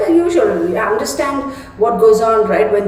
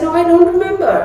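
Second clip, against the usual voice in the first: exasperated mom; 10 semitones higher, faster than usual. Retold or imitated. Mother snapping back at son.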